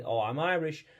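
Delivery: normal talking voice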